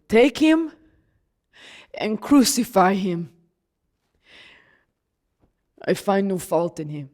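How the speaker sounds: a resigned ruler handing down a contradiction; Pilate's verdict. Acted. Pilate condemning Jesus while calling him blameless, low and resigned.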